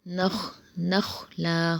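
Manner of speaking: نخلا with a thick, full-mouthed kha; نخلا with a heavy kha. Shown as a demonstration. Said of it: "اسے موٹا پڑھیں گے".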